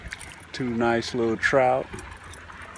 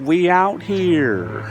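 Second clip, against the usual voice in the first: drawn-out excited; 7 semitones higher, slower than usual. Real, not acted. Drawn-out catchphrase.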